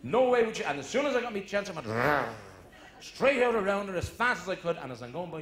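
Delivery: ordinary delivery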